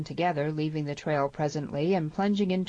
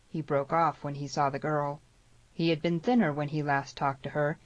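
plain reading voice

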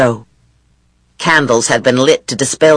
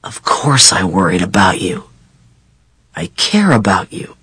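low intimate voice; 10 semitones lower than usual. A narrator's reading. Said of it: "it was in a low, intimate voice".

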